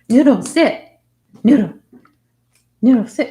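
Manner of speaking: loud, sharp command; firm dog command. Real, not acted. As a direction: tell the dog to sit, firmly.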